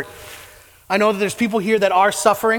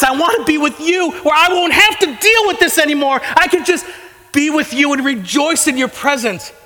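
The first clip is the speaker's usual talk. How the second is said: raised, urgent plea in a remembered prayer; desperate prayer; 5 semitones higher than usual. Retold or imitated. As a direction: retell the night you cried out, begging. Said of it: "just bawling and saying".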